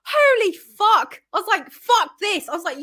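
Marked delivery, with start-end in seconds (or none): 0.1-1.2 slow, high, loud "holy fuck"; stunned, drawn-out curse, much higher and louder; shocked. Retold or imitated. Recalling shock at heckler. 1.7-2.5 high, loud exasperated curse; a higher, louder disgusted outburst; fed up. Retold or imitated. Recalling shock at heckler.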